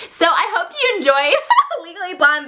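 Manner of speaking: real giggle through the words; amused, giggly sign-off; laughing. Real, not acted. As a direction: break into a high laugh mid-sentence.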